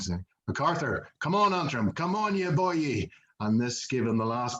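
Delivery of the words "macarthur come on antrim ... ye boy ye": put-on Irish accent cheering. Speaker retelling the legend of the crowd's Irish voice, high mock Irish cheer. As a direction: call out like an Irish voice from the crowd, urging him on. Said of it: "shouting his name in an Irish accent".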